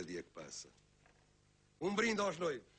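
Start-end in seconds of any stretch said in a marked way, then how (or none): 1.8-2.7 raised toast. Acted. Toast at a wedding.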